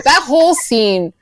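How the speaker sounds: normal talking voice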